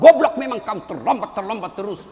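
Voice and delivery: loud angry insult; cursing lecturer. Retold or imitated. Lecturer curses late student, loud and angry. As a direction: berate him for being late again.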